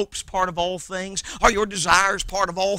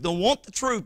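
ordinary delivery

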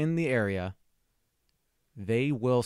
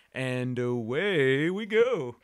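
announcer-style flourish; 3 semitones higher, 3 dB louder, much slower than usual. Acted. Showing off spinning chair.

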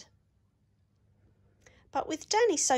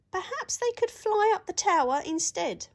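dinosaur's bright idea; 10 semitones higher, 4 dB louder than usual. Acted.

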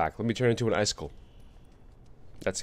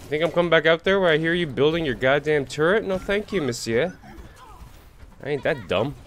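taunting; 7 semitones higher, 5 dB louder than usual. Real, not acted. Taunting enemy building turret.

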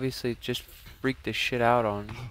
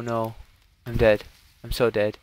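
ordinary talk, nothing marked

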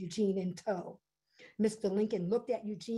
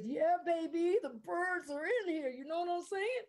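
hip Mister Lincoln voice; 9 semitones higher than usual. Acted. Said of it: "He was just like that".

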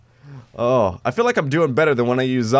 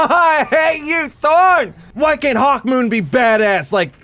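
high, drawn-out yell of hatred; angry shout; 9 semitones higher, 4 dB louder, slower than usual. Real, not acted. Gamer cursing the gun that killed him, high angry shout. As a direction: yell it high, stretching into the last word.

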